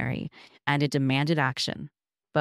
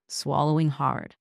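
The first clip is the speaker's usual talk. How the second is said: ordinary delivery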